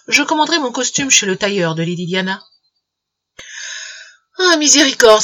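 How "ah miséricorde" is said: higher, louder cry of dismay; dazed exclamation. A narrator's reading. Uncle stunned by his nephew's torrent of talk, exclaiming. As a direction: cry out as if overwhelmed by chatter. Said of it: "s'écria monsieur Gresham".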